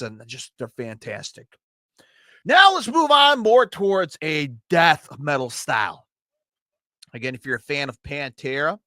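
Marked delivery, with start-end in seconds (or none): 2.4-6.1 excited. Real, not acted. Hyping the next album.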